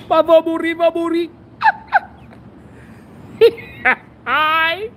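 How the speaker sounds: high, loud mock panic; yelping in fear; panicked. Real, not acted. Scared in the car.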